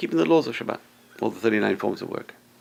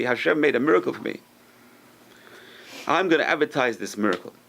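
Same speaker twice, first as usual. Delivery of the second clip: usual speaking voice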